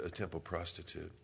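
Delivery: ordinary talk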